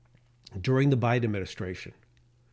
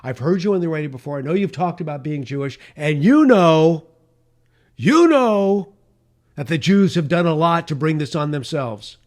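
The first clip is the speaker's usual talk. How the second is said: mocking a sly caller; 4 semitones higher, 9 dB louder than usual. Host mimicking the caller who turned on him, loud and sneering. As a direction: stretch the you know with mock contempt. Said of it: "And then they said".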